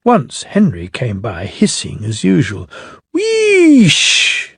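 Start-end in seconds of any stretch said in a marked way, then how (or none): 3.1-4.6 steam hiss. Acted. Engine hissing steam.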